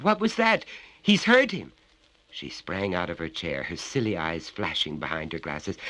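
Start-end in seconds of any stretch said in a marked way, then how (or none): 0.0-1.7 high, loud frightened outburst; alarmed. Acted. Betty panics at the sound upstairs, high and loud. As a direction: panic rising.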